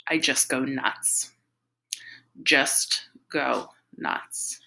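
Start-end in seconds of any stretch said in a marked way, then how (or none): 2.4-4.6 very slow, each word set apart; drawn-out repeat. A narrator's reading. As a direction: stretch it out word by word for dramatic effect. Repeats the line for effect.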